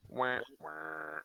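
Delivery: high-pitched "wah, wah" failure noise; mock sad trombone. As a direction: imitate a comic losing horn. Mock sad-trombone sound at failed spell.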